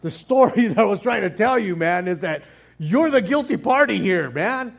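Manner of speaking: amused, exasperated telling-off; laughing exasperation. Pretend. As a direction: laugh through the rebuke as if at the end of your patience. Nathan exasperated at David.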